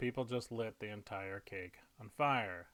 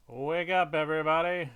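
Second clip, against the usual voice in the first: mock yelling; 3 semitones higher, 8 dB louder than usual. Loud letter E waking the town, mock yell. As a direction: call out loudly, only half shouting.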